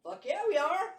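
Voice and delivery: loud, high, eager agreement; enthusiastic yes. Retold or imitated. Retelling her eager answer to Tommy, loud and excited. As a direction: shout your yes with glee.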